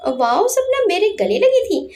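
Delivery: high, excited inner gush, rising on wow and landing on लगी; thrilled under his breath that Sapna hugged him; delighted to himself. Acted. Anurag thinks happily about Sapna's hug, gleefully excited. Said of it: "मन में खुश होकर बोला".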